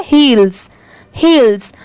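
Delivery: हील्स with a clear "s" after the "l"; final s sound. Shown as a demonstration. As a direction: end हील्स on an audible s. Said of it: "last में स की sound आएगी". (हील्स, अंत में साफ़ "स")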